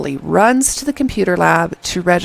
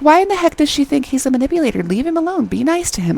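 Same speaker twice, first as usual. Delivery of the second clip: high-pitched protest for the underdog; indignant; 8 semitones higher, faster than usual. Retold or imitated. Viewer defending the underdog from Seol, indignant. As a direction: protest on his behalf, pitched up. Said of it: "I'm so mad at her".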